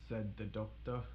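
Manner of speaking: usual speaking voice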